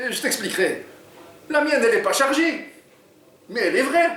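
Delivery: high, voiced reply from Jean; Jean telling Oumar his gun is real; sly robber. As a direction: answer with a knowing reveal.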